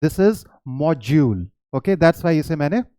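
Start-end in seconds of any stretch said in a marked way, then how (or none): 0.7-1.4 module said "MOJ-yool", slowly; "module" with a j sound. Shown as a demonstration. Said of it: "that is module". (module का उच्चारण "मॉज्यूल")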